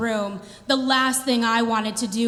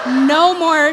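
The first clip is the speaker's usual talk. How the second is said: loud punched No more; emphatic; 3 semitones higher, 7 dB louder than usual. Real, not acted. Done with song-lyric dates, loud emphatic No more. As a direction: slam the door on it, louder.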